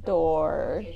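low, drawn-out mock "door"; mimicking odd delivery. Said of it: "why'd you say it like that?".

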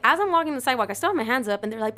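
ordinary delivery

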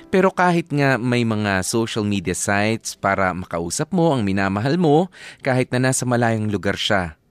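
usual speaking voice

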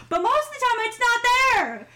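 very high, exaggerated denial; high-pitched protest. Denying to co-host that the accent shows, high-pitched protest.

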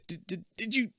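stammering. Acted. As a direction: stammer in shock. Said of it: "you stammer".

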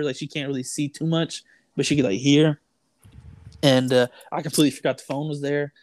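ordinary talk, nothing marked

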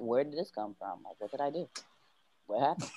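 low, hushed bewilderment; puzzled self-talk. Retold or imitated. Finding bruises after the party, quiet puzzled self-talk. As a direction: wonder softly under your breath.

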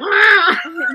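loud, high mock growl-yell; mimicked fight-scene yell; imitated weird noise. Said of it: "making the weirdest noises".

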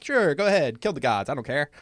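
loud, high careless dismissal; nonchalant. Acted. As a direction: wave it off like you don't care.

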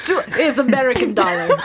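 laughing mock Russian accent. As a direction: insist on American dollars in a hammed-up Russian voice. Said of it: "my horrible Russian accent".

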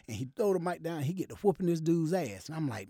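ordinary talk, nothing marked

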